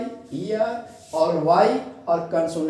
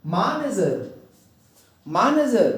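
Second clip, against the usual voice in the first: "manager" as MAN-uh-jer, falling away after MAN; first-syllable stress; much slower than usual. Shown as a demonstration. Teacher modelling the rule for students, stress on first syllable. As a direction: stress the MAN of "manager", short light ending. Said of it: "जोर देंगे".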